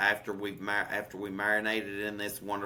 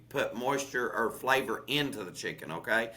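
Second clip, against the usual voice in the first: normal talking voice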